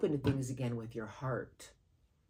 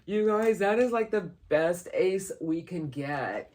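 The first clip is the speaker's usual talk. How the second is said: loud, high, thrilled outburst easing off; real excitement; 4 semitones higher, 6 dB louder, slower than usual. Real, not acted. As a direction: gush over a great find, slowing at the end. Thrilled at the card drawn.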